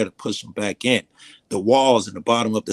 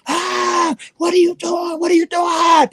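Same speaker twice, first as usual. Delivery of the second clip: shrill, very high panicked shrieking; terrified scream; 6 dB louder, slower than usual. Retold or imitated. Gang leader shrieks at the book in his lap, terrified scream. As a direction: scream like he's on fire. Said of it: "He started screaming like he was on fire".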